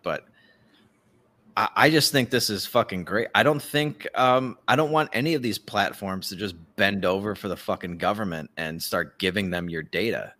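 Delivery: ordinary delivery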